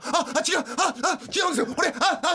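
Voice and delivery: high, stammered protest; flustered panic. Acted. Character caught holding wine stammers excuses, flustered and high. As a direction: blurt it out in a high, startled voice.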